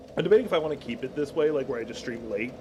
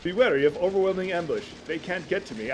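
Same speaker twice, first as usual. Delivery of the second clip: ordinary talk, nothing marked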